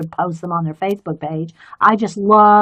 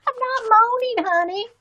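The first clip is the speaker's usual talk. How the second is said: playful protest; 12 semitones higher, 3 dB quieter than usual. Acted. Protesting to husband.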